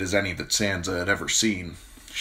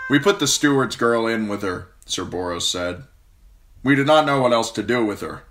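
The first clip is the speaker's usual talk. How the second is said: knight reporting; 4 semitones higher, 6 dB louder than usual. A narrator's reading. Knight explains to the queen.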